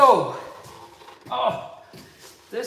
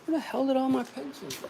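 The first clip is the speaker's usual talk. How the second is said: ordinary delivery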